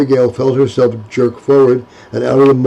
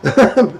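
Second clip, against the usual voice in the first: laugh; 8 semitones higher than usual. Acted. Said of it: "laughing and stuttering".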